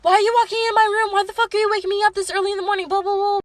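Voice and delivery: high, rapid-fire imitation of sister; fast, high-pitched mock griping; mocking bad attitude. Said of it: "she had the worst attitude ever".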